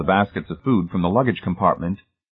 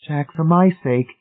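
pleading; 8 semitones higher than usual. A narrator's reading. Marcia pleading with Jack.

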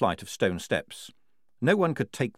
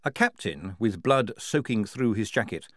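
usual speaking voice

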